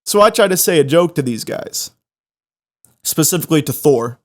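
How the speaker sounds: ordinary talk, nothing marked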